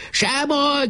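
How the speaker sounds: high-pitched battle cry; shouting. A narrator's reading. Boys shrug off a bomb hit, shouting high. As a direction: call out high without extra volume. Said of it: "kiáltották".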